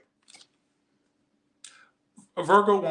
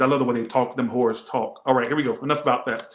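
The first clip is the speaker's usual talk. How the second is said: normal talking voice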